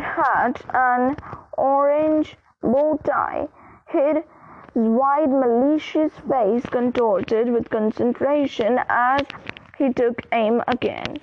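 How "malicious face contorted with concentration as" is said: ordinary delivery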